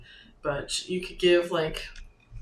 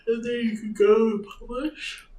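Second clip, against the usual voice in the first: talking through a yawn; 4 semitones higher, 4 dB louder than usual. Real, not acted. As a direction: say it mid-yawn. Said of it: "Sorry for the yawn".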